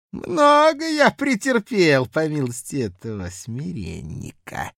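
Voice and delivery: put-on loud, high drunken bluster; drunken blustering official. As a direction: boast it loud like a tipsy clerk.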